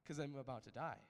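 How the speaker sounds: chuckling at the obvious; amused laugh over the words. Real, not acted. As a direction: say it through a laugh. Laughing at the absurd fear.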